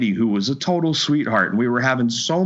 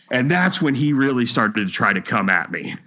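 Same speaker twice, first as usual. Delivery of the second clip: ordinary talk, nothing marked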